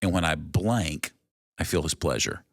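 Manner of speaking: slow, spaced-out prompt with pauses after each blank; fill-in-the-blank sentence. Shown as a demonstration. Leading guys through a fill-in exercise, slow and deliberate. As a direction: read it slowly, leaving room at each "blank". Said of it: "how would you answer this sentence".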